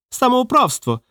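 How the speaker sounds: very high indignant squeal. A narrator's reading. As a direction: shriek it in pitch, without much added volume. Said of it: "вищав він".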